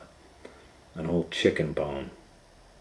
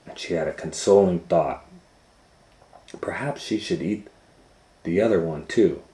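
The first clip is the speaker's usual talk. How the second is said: usual speaking voice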